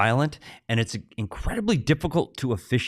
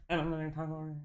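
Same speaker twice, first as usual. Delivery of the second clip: much softer, turned away from the mic; off-mic demo; 9 dB quieter, faster than usual. Shown as a demonstration. Host showing Craig how he sounds talking off-mic, distant and soft. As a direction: speak away from the microphone as if off-axis. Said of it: "you need to talk like this".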